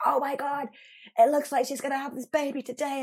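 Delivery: high, breathless-sounding alarm in a lightly voiced man; excited colleague. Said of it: "Piers came running past and he was like".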